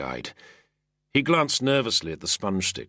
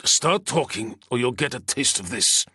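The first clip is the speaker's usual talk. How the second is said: threatening; 6 semitones higher than usual. Acted. Soldier threatening senator.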